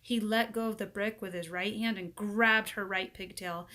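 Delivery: ordinary delivery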